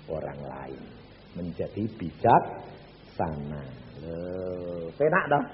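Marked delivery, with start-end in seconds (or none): none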